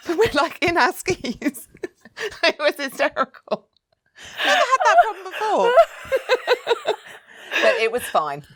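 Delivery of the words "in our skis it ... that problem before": high, laughing through the words. Real, not acted. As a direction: giggle through the memory. Laughing at her own story.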